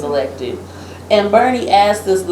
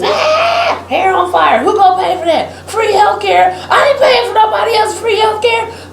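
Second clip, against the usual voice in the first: hammed-up panic over paying for others; mock outraged conservatives; 10 semitones higher than usual. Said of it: "all the conservatives".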